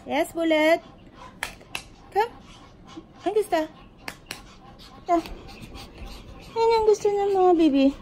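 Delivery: high, sing-song puppy talk. Real, not acted. Talking to the puppies.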